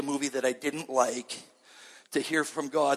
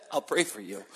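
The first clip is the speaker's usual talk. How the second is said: laughing through the brush-off; chuckling dismissal. Retold or imitated. Preacher retells refusing Ken's offer, laughing. Turning down low-paying youth job.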